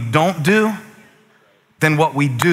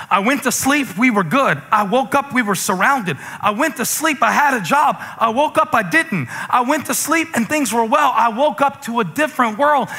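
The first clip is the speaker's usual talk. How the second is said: worried voice; 8 semitones higher than usual. Retold or imitated. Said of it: "This is like".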